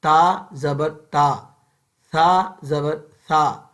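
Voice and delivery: "تا زبر تا" slow, mouth wide open, short a; slow drill with open mouth. Shown as a demonstration. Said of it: "سیدھا منہ کھول کر".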